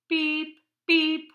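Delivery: high, loud robot beeps. Acted. Robots beeping again.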